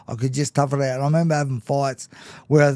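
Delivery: ordinary talk, nothing marked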